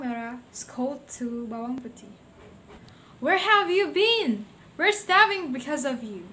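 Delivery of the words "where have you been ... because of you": loud, high angry scolding; furious stepmother yelling. A narrator's reading. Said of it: "said the stepmother angrily".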